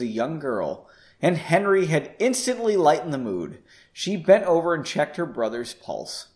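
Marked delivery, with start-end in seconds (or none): none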